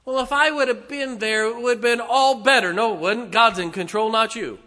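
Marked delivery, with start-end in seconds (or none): 0.0-2.7 higher, indignant claim; mock know-it-all protest; self-assured believer. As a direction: insist you could have fixed it. Preacher voices a self-important believer.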